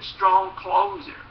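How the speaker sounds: ordinary delivery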